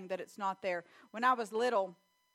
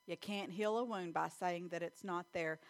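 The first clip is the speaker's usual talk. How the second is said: ordinary delivery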